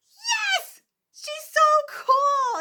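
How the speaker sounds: high, excited fangirl cheer; retold fan squeal. Fan recalling her favorite Peggy Carter moment, high excited squeal. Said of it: "'cause I'm like".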